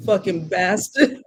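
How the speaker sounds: ordinary talk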